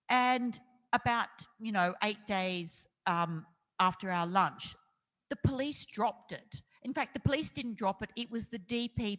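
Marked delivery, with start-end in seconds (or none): none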